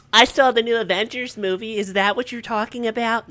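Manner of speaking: mocking kids who only know Avengers; mimicking kids. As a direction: play the clueless kid.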